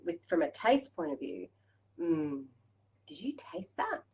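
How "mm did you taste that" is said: whispered question to yourself; barely voiced, intimate whisper; hushed savouring. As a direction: whisper it, lost in the taste. Acts out savouring a first taste.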